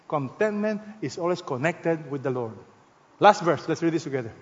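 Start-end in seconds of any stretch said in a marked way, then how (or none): none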